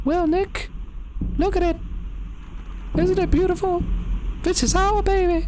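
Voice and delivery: very high put-on falsetto woman's voice; Marie. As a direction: squeak it out as an eerie Marie, phrase by phrase. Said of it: "Maybe this is Marie's voice".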